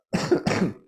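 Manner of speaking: dry unvoiced cough. Real, not acted. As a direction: clear the throat with a cough. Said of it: "coughing a lot today".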